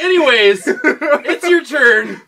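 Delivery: high, loud laughing holler; loud mock-brush-off, drawn out. Real, not acted. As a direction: belt it out high, stretching the words. Laughing shout handing over the turn.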